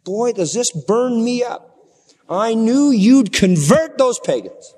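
loud, high, exasperated outburst; furious Jonah. Acted. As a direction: shout the grievance at God. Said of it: "he's furious".

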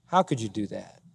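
ordinary talk, nothing marked

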